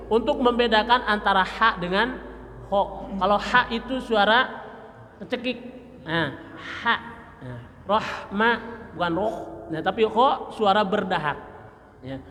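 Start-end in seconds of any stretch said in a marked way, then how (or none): none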